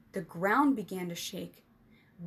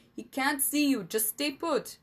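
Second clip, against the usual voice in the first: raised, louder, insistent order; urgent, commanding and higher; firm command; 5 semitones higher, 4 dB louder than usual. A narrator's reading. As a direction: keep a panicking friend in place. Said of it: "Rennie commanded".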